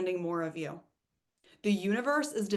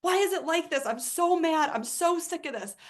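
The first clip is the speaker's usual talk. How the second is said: louder, higher venting; retold frustration; 7 semitones higher, 4 dB louder than usual. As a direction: let the frustration burst out. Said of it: "I sat down and I was like".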